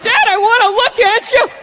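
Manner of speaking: put-on kid voice. Little son begging his dad to look, high childlike voice. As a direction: play the small son, high and sweet.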